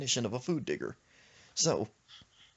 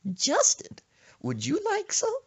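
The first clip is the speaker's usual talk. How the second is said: grandma offer; 5 dB louder than usual. Retold or imitated. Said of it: "He was like".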